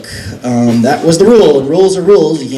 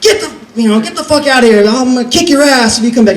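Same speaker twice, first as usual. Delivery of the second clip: angry bouncer; 9 semitones higher than usual. Retold or imitated. Bouncer throwing him out.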